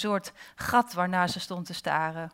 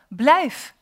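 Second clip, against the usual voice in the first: sudden loud, higher cry; calling out; 7 semitones higher, 8 dB louder than usual. A narrator's reading. Girl to a vanishing face, calling out. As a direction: call after someone slipping away. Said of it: "riep ze nog".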